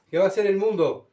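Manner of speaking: ordinary delivery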